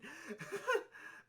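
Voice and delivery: high, rising burst of laughter. Real, not acted. Laughs at losing his own point.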